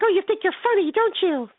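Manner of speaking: high-pitched mock indignation; mad woman mimicked. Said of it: "She was mad".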